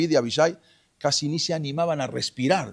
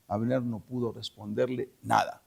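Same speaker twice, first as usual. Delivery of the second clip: ordinary delivery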